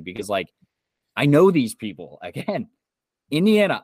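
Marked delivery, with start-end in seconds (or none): 3.3-3.8 exasperated. Real, not acted. Exasperated aside, laughing.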